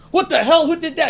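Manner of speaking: high, loud shout of disgust; mock outraged shout; outraged yell. Reacting to a fake fart.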